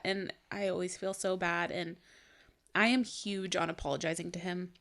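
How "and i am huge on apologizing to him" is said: ordinary talk, nothing marked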